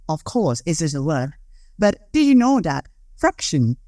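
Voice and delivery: ordinary talk, nothing marked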